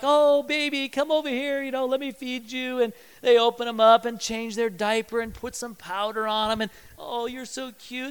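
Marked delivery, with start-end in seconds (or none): 0.0-2.8 high, cooing mom voice; tender imitation of a nurturing mother; doting mother. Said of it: "they're like". 7.0-8.1 slow, soft, high coo; drawn-out cooing over an infant; nurturing mother. Retold or imitated. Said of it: "they're nourishing and caring".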